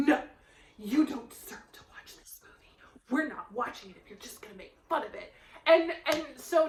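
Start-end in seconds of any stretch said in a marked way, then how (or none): 0.1-5.3 a low, whispered stern no; hushed stern refusal. Retold or imitated. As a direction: mom whispering a firm refusal to the kids. Mom refusing, quiet and firm.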